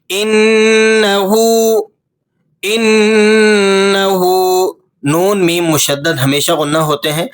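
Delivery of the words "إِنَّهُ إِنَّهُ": إِنَّهُ, noon held nasal and slow; ghunna on noon. Shown as a demonstration. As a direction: hum the doubled noon in إِنَّهُ. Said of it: "نون مشدد پر آپ نے غنہ ادا کرنا ہے". (إِنَّهُ میں نون مشدد پر غنہ)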